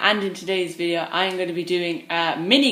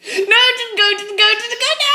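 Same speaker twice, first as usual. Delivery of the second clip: high loud panicked chant; terrified gibbering; 8 dB louder than usual. Real, not acted. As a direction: wail it in panic. Killer still there.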